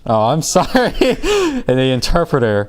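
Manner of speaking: giggling through a sheepish correction; laughing. Real, not acted. Teacher laughs at his own slip, correcting himself. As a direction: laugh while fixing the mistake. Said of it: "Did you just say compiler three consecutive times".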